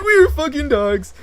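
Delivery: high, loud laughing wail; laughing mock cry. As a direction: cackle the line as a joke.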